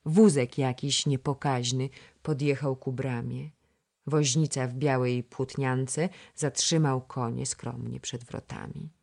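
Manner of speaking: ordinary delivery, nothing marked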